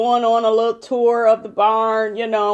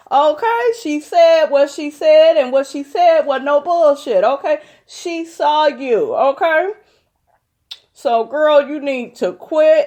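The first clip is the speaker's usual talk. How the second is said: sassy scolding; 6 semitones higher than usual. Real, not acted. Scolding Mariah directly.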